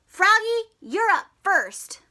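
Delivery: high, loud call to Froggy. Acted. Coach calling Froggy up to bat loudly. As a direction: call him to the plate.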